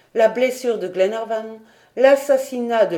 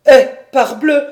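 loud, higher burst of exasperation; exclaiming; 6 semitones higher, 12 dB louder than usual. Acted. Paganel exclaiming to Glenarvan in exasperation. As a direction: blurt it out loudly. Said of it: "s'écria Paganel".

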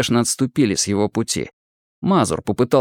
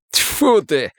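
higher, spat-out exclamation; spitting disgust; 5 semitones higher than usual. A narrator's reading. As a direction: spit it out, let down. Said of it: "сплюнул обнадёженный было Бурыба". (с досадой, будто сплёвывая)

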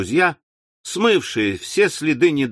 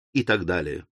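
fading low; 9 semitones lower, 6 dB quieter than usual. A narrator's reading. Repeated phrase fading out.

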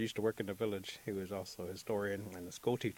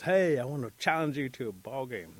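lightly voiced bold challenge, louder and higher; challenging Rabbit; 4 semitones higher, 7 dB louder than usual. A narrator's reading. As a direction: call out the challenge to the players.

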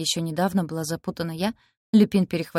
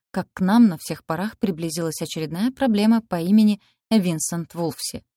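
ordinary delivery

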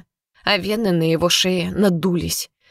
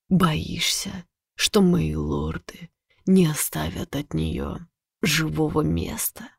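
slow, hushed threat; menacing whisper; 3 semitones lower, 5 dB quieter, slower than usual. Acted. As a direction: breathe the threat into her ear.

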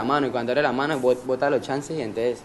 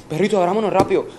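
raised, higher urgent voice; retold urging; 5 semitones higher, 6 dB louder than usual. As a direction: act out hurrying your accomplice. Apura al cómplice. (apuro imitado, voz alta y aguda)

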